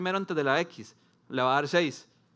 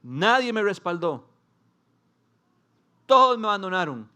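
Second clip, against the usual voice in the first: emphatic preaching; 4 semitones higher, 7 dB louder than usual. Acted.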